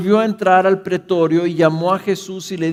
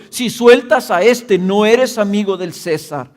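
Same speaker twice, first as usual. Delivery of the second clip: loud, higher threatening shout; crowd shouting; 5 semitones higher, 7 dB louder than usual. A narrator's reading. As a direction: hurl the threat at the governor. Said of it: "gritaron diciendo".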